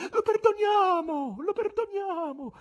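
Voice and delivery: very high, slow falling cries; women's voices in the crowd. A narrator's reading. Female spectators answering the showman, high pleading cries. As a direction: call out mercy, one voice after another. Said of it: "dapprima isolate e in prevalenza femminili".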